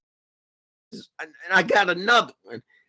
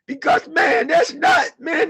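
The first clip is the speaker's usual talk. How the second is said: high, loud sobbing cry; real anguish bursting out; crying shout; 9 semitones higher, 5 dB louder than usual. Real, not acted. Continues crying outburst.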